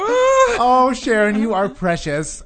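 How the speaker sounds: high, loud, drawn-out coo; gushing. Retold or imitated. Passenger adoring the outgoing lady, hammed-up gushing. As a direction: gush over her with a soaring "Oh". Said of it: "And I'm just like".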